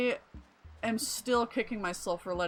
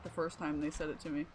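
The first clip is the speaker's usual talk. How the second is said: ordinary delivery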